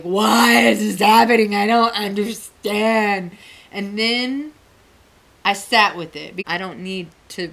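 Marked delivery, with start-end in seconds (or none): 0.0-3.3 imitated ugly-cry wail, loud at first; mock sobbing. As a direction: wail it out as if bawling, dragging the end. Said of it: "So I started sobbing, ugly crying, sobbing".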